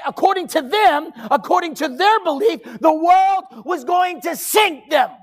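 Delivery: high, insistent preacher's voice; full-force preaching. Acted. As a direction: build each repeated phrase to a peak.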